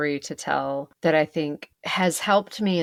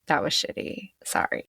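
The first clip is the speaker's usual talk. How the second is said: muttered apology; 5 dB quieter than usual. Pretend. Imagined grudging apology.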